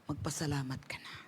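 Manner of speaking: hushed, breathy whisper. Acted. Preacher urging the congregation to give thanks, whispered. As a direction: drop to a whisper to draw them in.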